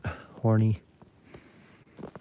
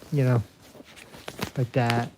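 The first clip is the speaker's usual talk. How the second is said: ordinary talk, nothing marked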